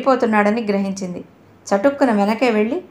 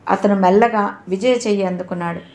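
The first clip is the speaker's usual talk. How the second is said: usual speaking voice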